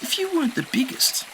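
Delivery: put-on high gentle kid; reassuring. A narrator's reading. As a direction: comfort him. Enki voice.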